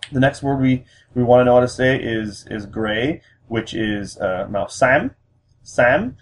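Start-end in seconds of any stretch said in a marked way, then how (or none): 5.6-6.1 xám climbing high at the end; rising tone. Shown as a demonstration. Teaching learners the word for gray, xám rising. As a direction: lift xám sharply upward. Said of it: "that is a rising tone".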